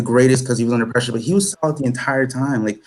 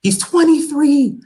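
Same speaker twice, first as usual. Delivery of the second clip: much higher and louder, in awe; amazed disbelief; 10 semitones higher, 3 dB louder than usual. Real, not acted. Fan marvelling that the young captain outclasses him, amazed. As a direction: pitch it way up in astonished admiration. Marvels at Ruben Dias' maturity.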